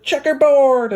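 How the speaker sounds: put-on high, loud kid's shout; shouted call. Classmate shouting at the changing girl, high and loud. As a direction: yell it across the classroom. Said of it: "someone else shouted".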